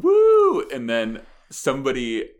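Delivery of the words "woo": one loud, high woo; woman wooing. Retold or imitated. Woman joining the crowd, loud high woo. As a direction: imitate her cheering along. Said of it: "And she's going".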